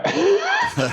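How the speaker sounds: high laugh; burst of laughter. Real, not acted. Laughs at own joke.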